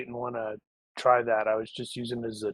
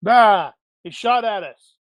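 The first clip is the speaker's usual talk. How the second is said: high, loud yelp of alarm; alarmed cry; 9 semitones higher, 11 dB louder, slower than usual. Acted. Player to party after shots ring out, alarmed cry. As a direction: cry out as if under fire. Player cries out at being shot at.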